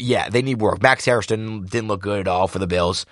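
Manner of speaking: ordinary delivery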